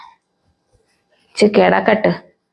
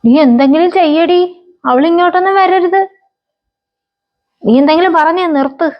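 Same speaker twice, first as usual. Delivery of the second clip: panicked pleading; 10 semitones higher than usual. Acted. Mother urges daughter to stop the daughter-in-law, panicked pleading. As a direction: beg her frantically to do something.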